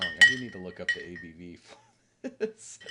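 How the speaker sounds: tipsy, loud and giggly. Real, not acted. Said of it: "I'm a little tipsy right now".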